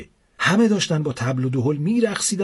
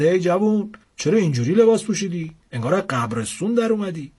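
called out; 5 semitones higher than usual. A narrator's reading. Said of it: "یکی داد زد".

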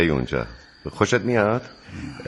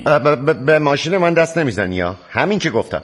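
loud, stammering, higher outrage; angry stammer; 7 semitones higher, 5 dB louder than usual. Acted. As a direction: sputter in outrage. Brother threatens to take his car.

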